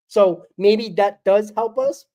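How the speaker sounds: usual speaking voice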